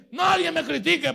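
loud high outburst; defiant shout. Retold or imitated. Pastor answering neighbors' gossip, defiant shout. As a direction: bark it back at the critics.

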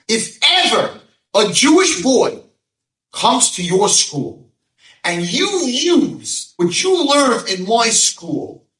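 high, loud, slow declaration; emphatic. Acted. Rav Noach proclaiming his condition, loud and slow. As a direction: hammer each word of the condition. Rabbi sets condition for forgiveness.

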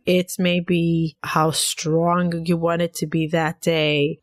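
ordinary delivery

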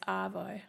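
"Arvo", the Australian way, dropping the R. Shown as a demonstration. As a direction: say "Arvo" as AH-voh, with no R sound. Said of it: "We don't really pronounce the R".

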